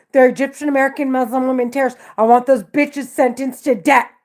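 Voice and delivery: loud, urgent and distressed; escalating agitated rant. Real, not acted. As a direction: push it out, pleading to be heard.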